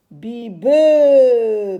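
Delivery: "Bi, be" loud, the "be" held long; syllabes étirées. Shown as a demonstration. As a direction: sound out "Bi, be", lingering on the second syllable. Teacher sounds out syllables for children. (« Bi, be » fort, en allongeant le « be »)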